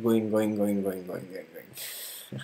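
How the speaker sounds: going fading to a whisper; fading repetition. A narrator's reading. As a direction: let it fade away as they sail off. Journey fading into distance.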